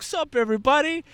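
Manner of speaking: high-pitched mock hello; awkward greeting. Twenty-year-old walking into the party, high mock greeting. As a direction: pitch it way up as a naive newcomer.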